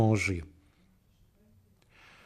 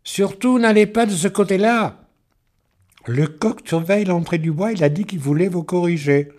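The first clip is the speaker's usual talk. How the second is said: high, loud urgent warning; a girl's high, loud cry of warning; cried out warning; 7 semitones higher, 6 dB louder than usual. A narrator's reading. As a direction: call out to stop him going. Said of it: "Delphine s'écria".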